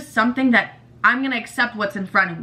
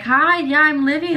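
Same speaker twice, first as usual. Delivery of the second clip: polite introduction; 6 semitones higher than usual. Retold or imitated. Introducing herself to other parents.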